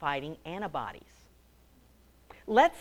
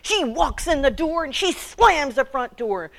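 put-on raised, lively narration of a stomping girl; vivid, higher narration; animated storytelling; 6 semitones higher, 4 dB louder than usual. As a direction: tell it like the stomps are happening.

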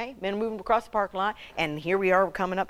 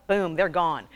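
ordinary talk, nothing marked

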